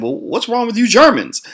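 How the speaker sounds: high-pitched mock-offended comeback; offended retort. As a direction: throw the question back, playing offended. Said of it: "of course, I got offended".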